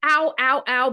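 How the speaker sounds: acting out the ankle pain; retold pained ow. Retelling her injury to Audrey, mock pained ow.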